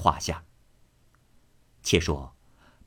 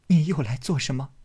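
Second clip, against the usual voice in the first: higher, hurt, petulant; tearful reproach; 6 semitones higher than usual. Acted. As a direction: reproach him through tears. Daiyu weeping reproach.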